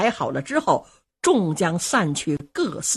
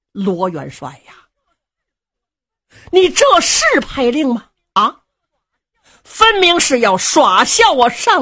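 angry rebuke; 4 dB louder than usual. Acted. Elder cousin scolding the marshal in open hall, angry loud rebuke. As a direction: thunder at him, outraged.

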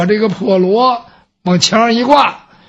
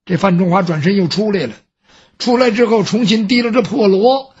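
ordinary delivery, nothing marked

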